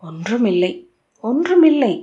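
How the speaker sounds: ordinary delivery, nothing marked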